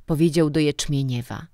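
ordinary delivery

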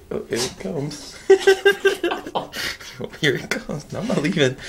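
ordinary talk, nothing marked

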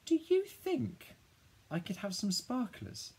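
high-pitched pleading squeak. A narrator's reading. Child asking Dad for sparklers, very high squeaky voice. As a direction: squeak it very high, drawn out at first. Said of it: "my voice goes so high only dogs can hear it".

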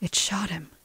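stunned, barely audible whisper. A narrator's reading. Lena has just shot Frank, whispering. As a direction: whisper it in shock. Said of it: "Lena whispered".

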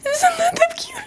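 excited whisper. Real, not acted. Showing gift excitedly.

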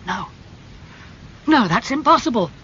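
horrified outcry of disbelief; cried out. Acted. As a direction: cry out against the terrible news. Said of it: "cried Dorian".

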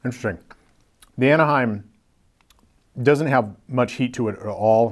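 talking between bites; chewing while talking. Real, not acted. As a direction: mouth partly full of food. Seen 3: Holds burger after bite; lips closed and working between words.

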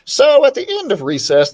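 usual speaking voice

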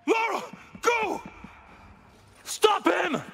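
urgent shouted command; calling out. Acted. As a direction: yell the order across the chaos. Calling out during escape.

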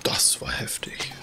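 awed whisper. Real, not acted. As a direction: breathe it out in awe. Awed reaction after spectacle.

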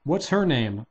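ordinary delivery, nothing marked

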